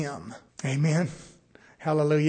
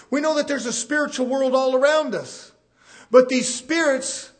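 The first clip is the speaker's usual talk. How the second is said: raised, driving pulpit voice; preaching; 6 semitones higher, 6 dB louder than usual. Real, not acted. Preacher warning congregation about spirits around them, forceful preaching.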